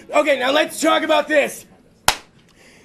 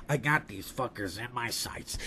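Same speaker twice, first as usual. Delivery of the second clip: slow, very deep, hushed elephant; Jumbo; 15 dB quieter than usual. Acted. Elephant takes aim at the hunters, slow deep menace. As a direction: drop low and quiet, stalking them. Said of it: "I imagine that's how he sounds".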